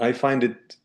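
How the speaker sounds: usual speaking voice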